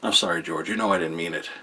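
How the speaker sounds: lightly imitated hollow sorry, dropped low and soft; a hint of a guilty son mumbling sorry; meek apology. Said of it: "and then he's like".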